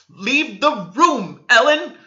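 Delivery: high, loud, slow furious order; trembling command. A narrator's reading. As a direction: order her out, shaking with rage. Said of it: "trembling all over".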